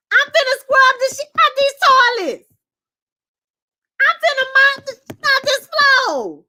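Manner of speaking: sing-song mock of someone too eager to serve; a squeaky put-on voice promising to scrub toilets; high mocking helper voice.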